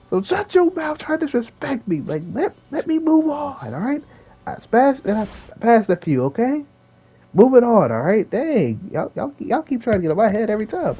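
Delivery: high-pitched indignant grumbling; fed up. Real, not acted. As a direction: brush off the hecklers in a squeaky huff. Fed up with imagined critics.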